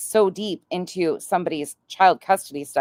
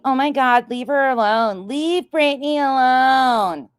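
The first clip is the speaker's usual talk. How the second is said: high, loud mock pleading, slowing on Leave Brittany alone; mocking whiny voice; 7 semitones higher, 4 dB louder than usual. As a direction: sneer at the people defending her.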